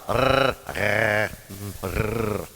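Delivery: Rrr as a long trilled r; rolled r. Shown as a demonstration. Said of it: "Can you roll your, roll your R's?".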